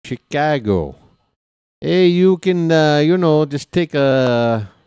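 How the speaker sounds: a hammed-up, slipping Chicago accent that starts bad and gets worse. Streamer urging viewers to fly to Chicago, in a bad Chicago accent. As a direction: put on a mock Chicago accent while you urge your viewers to fly to Chicago. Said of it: "I don't know where that accent was going".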